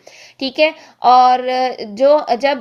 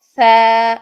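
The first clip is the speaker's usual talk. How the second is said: बा held for two counts, slow and louder; two-count stretch; 8 dB louder than usual. Shown as a demonstration. Teacher recites बा stretched for two seconds. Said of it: "दो second लंबा किया".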